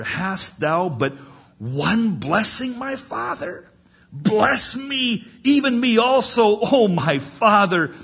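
high, slow acted cry, swelling loud; wailing plea. Esau begging his father for one blessing, wailing. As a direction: plead upward into a loud wail. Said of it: "Esau lifted up his voice and".

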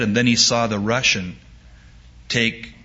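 ordinary talk, nothing marked

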